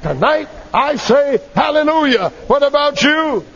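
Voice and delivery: rally the congregation, pitched high; exultant pulpit call; rousing preacher's cry. Real, not acted. Preacher takes up shout, rouses congregation.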